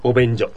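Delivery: "お便所" for Avenger, starting with o; Korean-style "Avenger". Retold or imitated. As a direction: say "お便所" as a mock Korean obenjo. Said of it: "復讐者という意味のアベンジャーですよね".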